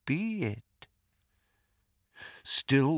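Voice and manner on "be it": soft, final villain's verdict; quiet menace. A narrator's reading. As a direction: close the threat very softly. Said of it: "he said quietly".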